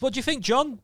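high, loud toff voice; posh commentator impression. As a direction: ham up a plummy English pundit. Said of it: "it goes".

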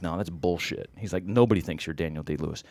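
low, quiet disbelief; unimpressed friend, deadpan and soft; dry dismissal. Retold or imitated. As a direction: brush it off under your voice.

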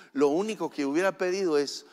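ordinary talk, nothing marked

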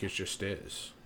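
mock dismissive murmur; imitated lame answer. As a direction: drop it right down, imitating a weak non-answer. Others' feeble answer to his question.

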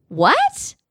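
sharp high rising what; can't believe it; incredulous. Real, not acted. Reacting to dad's texting idea.

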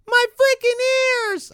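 put-on very high, slow child's cry; kid voice. Imitating Rod and Todd, high slow wail. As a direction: squeal it like a pained little boy. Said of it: "trying to get the cadence of, of Rod and Todd".

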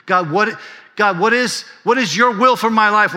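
high, loud, insistent pulpit delivery; fervent preaching. Acted. Preacher pressing the congregation on God's will, fervently. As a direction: drive it home to the congregation.

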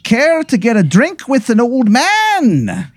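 high, drawn-out stage-Irish leprechaun; horrendous Irish accent. Acted. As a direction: invite them in a hammy, slow Irish brogue. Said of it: "a horrendous accent".

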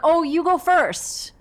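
high, slow, loud sing-song; trying to hide the wet pants; overly polite nervous push; nervous insistence. Retold or imitated. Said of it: "I'm like".

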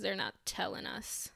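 hushed secret aside; conspiratorial whisper. Real, not acted. Conspiratorial aside about aliens.

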